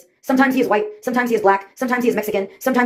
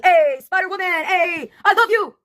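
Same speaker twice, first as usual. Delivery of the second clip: imitated teenage shout; 8 semitones higher, slower than usual. Teenagers yelling at Spider-Woman from a car window, mock high-pitched shout. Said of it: "they shouted at me".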